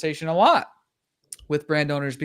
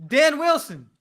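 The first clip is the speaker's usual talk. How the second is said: fired-up hype; 9 semitones higher than usual. Real, not acted. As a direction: pump them up like a coach.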